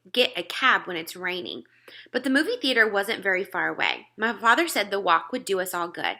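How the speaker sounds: ordinary delivery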